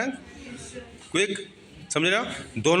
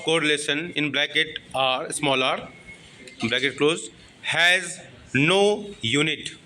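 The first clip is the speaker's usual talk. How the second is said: has no unit drawn out, no lifted; slow stress on no; slow dictation. Shown as a demonstration. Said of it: "dictating slowly".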